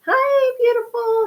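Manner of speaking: high, loud squeal of a hello; excited high greeting. Real, not acted. Host squealing hello to a viewer in chat, excited. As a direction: greet her like a long-lost friend.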